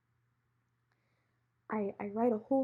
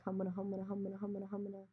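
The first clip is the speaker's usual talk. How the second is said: soft, rapid nonsense babble; embarrassed mumbling; 8 dB quieter, much faster than usual. Retold or imitated. Girl slinking out of the lobby, quiet flustered mumbling. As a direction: mumble it under your breath, trailing away softer. Mumbles to herself while leaving.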